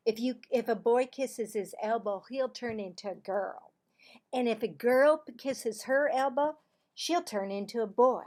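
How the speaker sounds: loud, bright schoolgirl telling a secret; Cassie's voice. Acted. Girl telling Marvin a playground rumour, loud and eager. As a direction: play the know-it-all girl, loud and sure.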